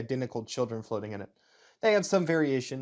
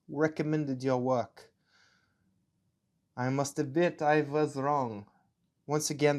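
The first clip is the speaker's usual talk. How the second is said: put-on deliberate German officer, accent drifting towards Russian. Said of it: "my German accent slips a little into Russian".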